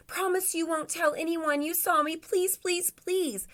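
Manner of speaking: high, begging storybook voice; pleading Santa. Acted. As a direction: beg them to keep the secret. Santa begs the bears.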